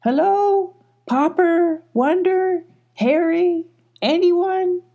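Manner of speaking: very high, drawn-out searching calls; calling out. A narrator's reading. Wallow searches for hidden friends, calling very high. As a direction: send each name up as a held question. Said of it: "Keep calling".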